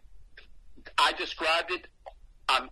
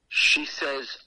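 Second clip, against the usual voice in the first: ordinary talk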